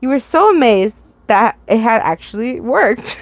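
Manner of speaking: ordinary delivery, nothing marked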